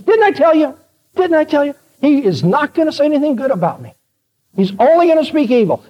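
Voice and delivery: high-pitched mock indignation; indignant complaint. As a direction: complain like a vindicated, aggrieved king. Acted king complaining to ally.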